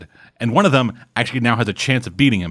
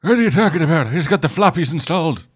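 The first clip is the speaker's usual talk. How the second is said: grandpa voice; 7 semitones higher than usual. Retold or imitated. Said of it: "it's like".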